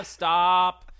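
normal talking voice

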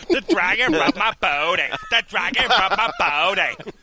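laughing through the words. Real, not acted. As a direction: giggle it out. Repeating while laughing.

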